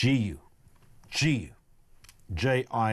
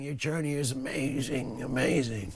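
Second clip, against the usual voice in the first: Biden; 2 semitones higher than usual. Retold or imitated. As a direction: imitate a gushing politician, hushed and awed. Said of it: "And Biden was going".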